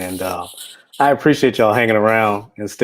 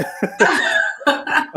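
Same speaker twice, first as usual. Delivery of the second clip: high, airy laugh with almost no voice; real unvoiced chuckle; silent laugh; 12 semitones higher than usual. Real, not acted. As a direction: laugh under the breath at the timing. Laughing at the guest leaving.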